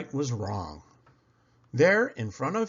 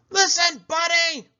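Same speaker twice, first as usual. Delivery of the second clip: much higher, loud yell; 7 dB louder than usual. Acted. Parents yelling at Buddy to listen, loud. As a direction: shout to get his attention. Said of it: "His parents tried yelling".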